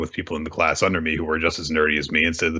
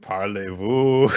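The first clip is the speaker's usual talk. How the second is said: mock French; 2 semitones higher, much slower than usual. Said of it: "This is how you speak French".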